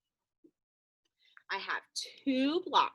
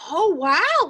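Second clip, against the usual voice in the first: bright, louder, higher cheer; eager teacher excitement; excited wow; 8 semitones higher, 11 dB louder than usual. Acted. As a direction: act amazed at the clapping. Reacting to kids' loud clapping.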